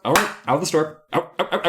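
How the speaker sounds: shooing out. Acted. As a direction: chase the girl out of the store. Shopkeeper shooing her out.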